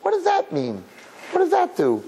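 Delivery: high, incredulous demand; scornful challenge. Real, not acted. Rabbi dismissing mere pity, high and scornful. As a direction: throw the question back at them.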